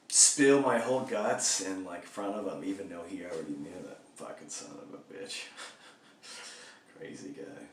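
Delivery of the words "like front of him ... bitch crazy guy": ordinary talk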